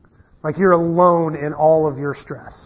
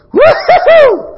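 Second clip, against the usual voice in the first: much higher, much louder laughing woo-hoo, slow; gleeful whoop; 9 dB louder, slower than usual. Retold or imitated. Son sliding off the roof, imitated laughing whoop. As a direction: whoop like falling is a thrill. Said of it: "he goes".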